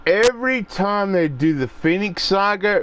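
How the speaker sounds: usual speaking voice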